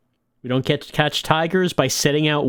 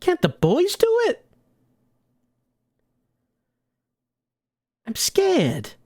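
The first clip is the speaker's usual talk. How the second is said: much higher, pleading dame voice; scared moll; slower than usual. Acted. Moll begging her boss to send the boys, high and scared. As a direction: beg like a frightened gangster's girl. Voicing the gangster's girl.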